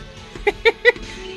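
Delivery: high, voiceless chuckle. Acted. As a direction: giggle in a string of short puffs. Captain chuckles over the girl.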